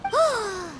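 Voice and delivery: drawn-out, falling gasp of surprise; shocked gasp. Acted. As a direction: gasp at alarming news. Companion shocked at being overheard.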